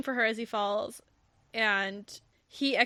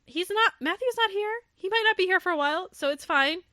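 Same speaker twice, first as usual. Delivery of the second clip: put-on higher, quicker girlish voice for Rion; Rion voiced; 8 semitones higher, 3 dB louder than usual. Rion brushing off Archer's worry in a higher, rushed voice. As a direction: pitch up and rush it, lightly. Rion reassures about absent Matthew.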